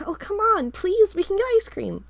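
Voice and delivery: put-on high, loud little-boy whine; pleading kid brother. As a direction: beg like a cheeky younger brother.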